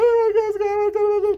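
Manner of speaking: put-on high, loud pleading child voice; whiny kid protest. Boy begging his mom about the field trip, whiny.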